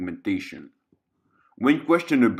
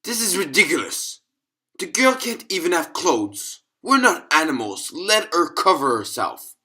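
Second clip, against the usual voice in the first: higher pitched, exasperated objection; appalled colleague speaking up, higher; indignant protest; 6 semitones higher than usual. Acted. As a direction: push back against a cruel order. Staffer objects to denying the girl clothes.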